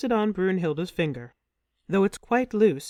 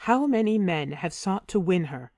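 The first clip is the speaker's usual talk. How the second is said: ordinary delivery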